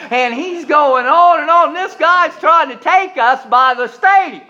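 rising preacher excitement; building preaching. Acted. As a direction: crank the story up for the crowd. Preaching climax.

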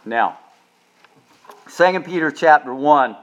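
usual speaking voice

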